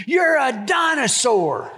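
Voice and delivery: put-on high, slow laughing kid taunt; kid's insult. Son insulting his brother, high laughing taunt. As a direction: say it like a mad little boy. Son's new insult retold.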